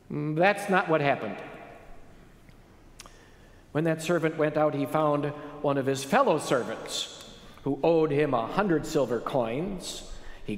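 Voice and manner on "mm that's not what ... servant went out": usual speaking voice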